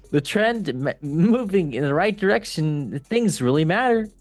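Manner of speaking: mock baseball-fan voice. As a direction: read the owner's quote like a baseball guy. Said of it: "like the baseball one".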